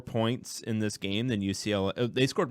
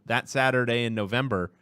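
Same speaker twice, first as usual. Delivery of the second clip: usual speaking voice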